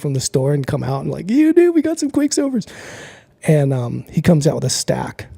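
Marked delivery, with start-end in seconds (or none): 1.3-2.7 high excited buddy voice. Retold or imitated. Friend's excited greeting retold.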